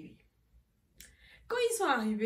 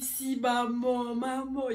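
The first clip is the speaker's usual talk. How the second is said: mock wail; 3 semitones higher, 5 dB louder, much slower than usual. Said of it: "J'ai dit".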